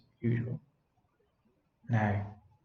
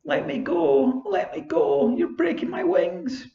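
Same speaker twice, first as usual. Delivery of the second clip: much higher, tearful pleading cry; weeping fairy plea; 14 semitones higher than usual. Acted. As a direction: beg to be let go, near tears. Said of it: "frightened little prisoner was weeping loudly".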